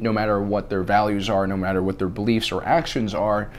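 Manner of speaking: ordinary talk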